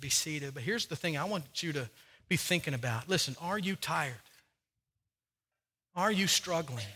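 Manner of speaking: soft, low pastoral appeal; gentle altar call. Acted. Quiet altar call, communion.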